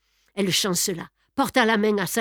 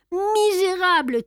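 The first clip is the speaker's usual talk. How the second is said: high, sudden cry of hatred; furious outburst at Sholmès; hateful cry; 10 semitones higher, 3 dB louder than usual. A narrator's reading. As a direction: hurl the word in a fit of hate. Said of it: "cria-t-il tout à coup dans un accès de haine".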